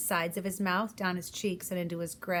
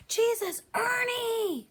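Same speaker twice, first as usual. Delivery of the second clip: high, loud pained scream; 3 dB louder, much slower than usual. Acted. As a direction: scream the name in panic. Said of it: "Ernie was screaming".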